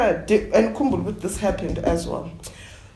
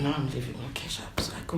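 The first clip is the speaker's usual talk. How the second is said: whispered aside, voice dropped; a soft whispered aside; 6 semitones lower, 10 dB quieter, faster than usual. Real, not acted. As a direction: hush it like a secret.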